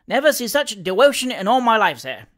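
lively dialect servant reporting with relish; Sam Weller, cheeky manservant. Acted. Valet telling his master of the election, animated Cockney.

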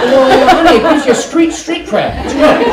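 high and loud, laughing as he speaks; laughing through words. Retold or imitated. Telling his wife through laughter, laughing loud. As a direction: get the words out between laughs. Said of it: "his reply to wife, laughing".

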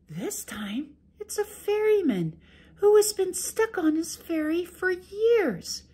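high sweet wife making excuses. Acted. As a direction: play innocent to the ogre, high and gentle. Wife tells dream.

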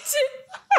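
high burst of laughter. Real, not acted. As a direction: laugh out in surprise. Reacting to cookbook news.

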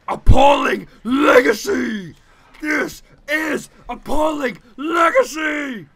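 slow, high full-voice mock outrage; mock outraged shout. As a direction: bellow it like an outraged politician. Mock outraged parliament speech.

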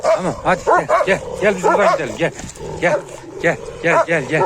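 real anxious shouting; urgent loud calling. Real, not acted. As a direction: coax the dog away fast. Urging dog away loudly.

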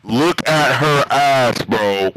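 loud exclamation. Real, not acted. Gamer to a friend, loud and drawn-out. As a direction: blast it out, drawing each word out. Same line, then loud take.

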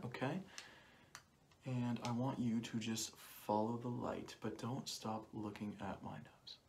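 ordinary delivery

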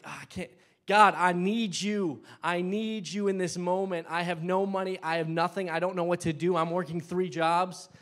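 high, loud, pleading cry to God. Shown as a demonstration. A broke believer crying out to God, loud and desperate. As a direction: plead with everything you've got. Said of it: "get a little bit louder and a little bit bolder".

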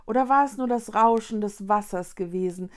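ordinary delivery, nothing marked

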